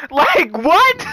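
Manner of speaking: high squeaky laughing what; cracking up in disbelief. Real, not acted.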